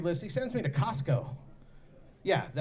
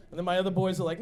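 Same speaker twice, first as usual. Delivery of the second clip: ordinary talk, nothing marked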